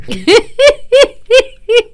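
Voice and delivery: squealing high laugh; real laugh breaking up. Real, not acted. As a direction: burst out in a high-pitched laugh. Laughs at own joke.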